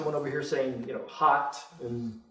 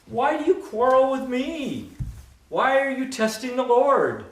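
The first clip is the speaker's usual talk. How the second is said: Moses pleading, pitched up high; voicing Moses; 9 semitones higher than usual. Moses protesting to the grumbling people, high and pleading. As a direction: cry out as an exasperated Moses.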